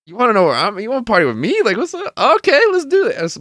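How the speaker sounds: high-pitched, animated retold call; acted phone banter. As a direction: re-enact it way up high, playfully. Acting out his phone call.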